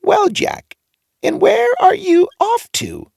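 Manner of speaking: very high squeaky greeting; funny old man. A narrator's reading. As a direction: greet him like an odd little old man.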